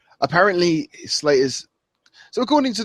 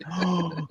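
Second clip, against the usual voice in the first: high, soft intake of breath; quick quiet gasp; 6 semitones higher, 7 dB quieter than usual. Real, not acted. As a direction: gasp in realisation. Delighted by the coined name.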